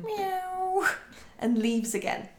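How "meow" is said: high mock meow; a cat's short meow, pitched high.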